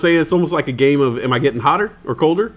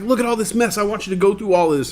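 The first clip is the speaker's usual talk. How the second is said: higher scolding mom voice; nagging mom; 7 semitones higher than usual. Retold or imitated. Mom scolding son about messy drawers, high nag. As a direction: mimic the fed-up mother. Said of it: "she was just like".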